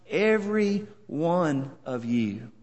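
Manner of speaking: EVERY ONE OF YOU slowed and spaced word by word; slow, weighty stress on each word of EVERY ONE OF YOU; emphatic. Shown as a demonstration. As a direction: set EVERY ONE OF YOU apart, softening toward the end. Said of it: "there's emphasis there".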